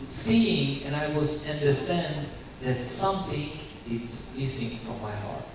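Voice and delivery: normal talking voice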